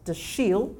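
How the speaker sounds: "Dashiell" said duh-SHEEL, slowly; light stress on the second syllable of "Dashiell", duh-SHEEL; French pronunciation. Shown as a demonstration. Granddaughter teaching her grandfather's name, French pronunciation of "Dashiell" as duh-SHEEL. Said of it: "if you pronounce it with a French pronunciation".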